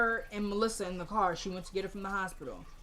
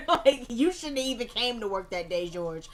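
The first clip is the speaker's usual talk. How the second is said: laughing, louder burst of commentary; real amusement; 5 dB louder than usual. Real, not acted. Narrator mocking the beaten lawyer, laughing. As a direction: laugh through the put-down.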